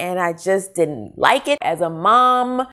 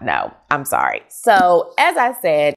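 normal talking voice

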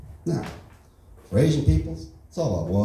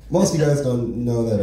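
ordinary talk, nothing marked

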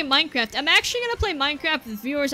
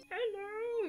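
high soft cooing hello; baby talk to dog; 8 semitones higher, 17 dB quieter than usual. Acted. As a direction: coo at the dog. Greeting the dog for him.

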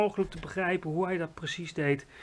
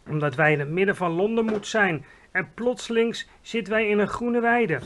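louder, bewildered giant voice; can't believe it's a meadow; lightly voiced baffled giant; puzzled giant; 4 semitones higher, 6 dB louder than usual. A narrator's reading.

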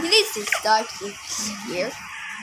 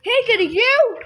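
normal talking voice

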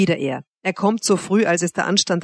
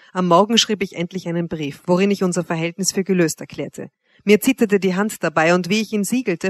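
usual speaking voice

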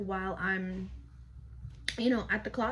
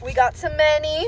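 celebrating; 6 semitones higher, 11 dB louder than usual. Real, not acted. Celebrating money earned at club.

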